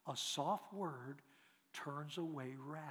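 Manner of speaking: slow, hushed, low delivery; soft scripture quote. Shown as a demonstration. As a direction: say it gently and deliberately, showing a soft word. Quotes scripture about soft words.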